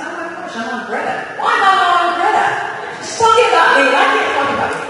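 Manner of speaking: high, rising alarmed exclamations; shocked. Retold or imitated. Speaker retelling her shock at seeing herself on TV. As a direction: panic at seeing yourself on TV.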